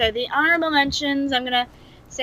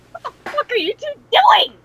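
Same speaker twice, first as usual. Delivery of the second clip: mock outraged shout; 5 dB louder than usual. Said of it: "Zelig was like".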